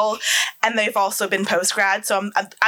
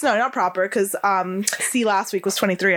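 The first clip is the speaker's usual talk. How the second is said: usual speaking voice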